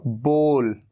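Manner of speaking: बोल said low and drawn out, with a long o and not ow; careful pronunciation. Shown as a demonstration. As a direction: give बोल a clean long o. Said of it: "ये होता है".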